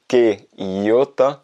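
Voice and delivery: slow, stretched qué idiota; slow demo. Shown as a demonstration. Teacher slowly pointing out the accented qué. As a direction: draw it out to show the accent. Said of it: "tu peux voir".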